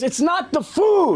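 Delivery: high, slow mock exasperation; exasperated protest. Diner correcting the clueless waiters, exasperated. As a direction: stretch it out, imitating a fed-up diner.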